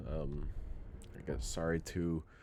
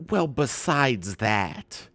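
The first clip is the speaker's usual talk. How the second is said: slow, louder dismissive grandma; 5 semitones higher, 8 dB louder, much slower than usual. Retold or imitated. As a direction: drag it out, brushing it off.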